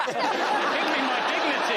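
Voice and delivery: slow, high pleading through laughter; laughing plea. Real, not acted. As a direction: beg while laughing.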